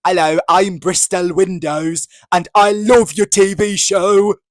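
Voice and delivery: loud, high mock English accent; hammed-up worst-ever English accent, drawn out; terrible English accent. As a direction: do an over-eager fan's awful English accent. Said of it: "doing the worst English accent".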